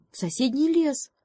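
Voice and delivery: high, bright-eyed hare's idea; joyful suggestion. Acted. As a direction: pitch it up with sudden joy. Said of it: "радостно посоветовал заяц".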